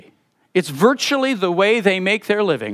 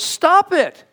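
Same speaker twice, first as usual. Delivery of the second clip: sharp rebuke; 11 semitones higher than usual. Retold or imitated. Paul scolding the divided Corinthian church, sharp and high. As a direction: cut the squabbling off short.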